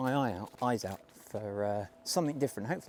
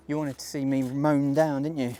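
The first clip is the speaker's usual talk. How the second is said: teasing; 3 semitones higher, 9 dB louder than usual. Real, not acted. Teasing camera about being trampled.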